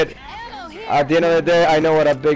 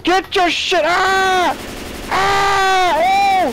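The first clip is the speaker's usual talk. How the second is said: high drawn-out yells; panicked screaming; much slower than usual. Real, not acted. Player overwhelmed while ordering teammates, panicked screaming. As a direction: scream as the horde overwhelms you.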